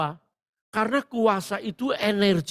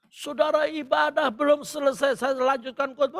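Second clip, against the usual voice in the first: raised-pitch pulpit announcement; insistent preacher; 8 semitones higher than usual. Retold or imitated. Preacher tells congregation the service continues, insistent high voice. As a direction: re-enact pressing on over the commotion.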